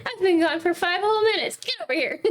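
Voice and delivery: high-pitched playful indignation; mock scolding. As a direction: call the pet over in pretend outrage.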